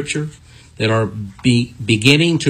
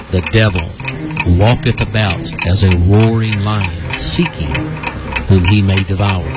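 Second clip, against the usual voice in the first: low, measured announcer voice with solemn pauses; programme sign-off; 6 semitones lower than usual. Acted. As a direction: deliver a grave closing charge to listeners, phrase by phrase. Recorded-style programme sign-off.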